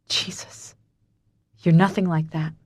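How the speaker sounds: hoarse, shaken whisper. Acted. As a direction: rasp it out, shaken. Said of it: "I say hoarsely".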